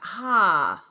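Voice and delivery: sudden loud pained yelp; startled yelp. Real, not acted. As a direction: cry out as the hit lands. Hit by a recoil attack.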